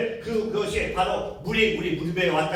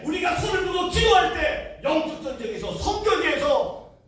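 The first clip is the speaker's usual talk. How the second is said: fiery preaching; 9 semitones higher, 4 dB louder than usual. Acted. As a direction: preach it to the rafters. Preacher builds to climax.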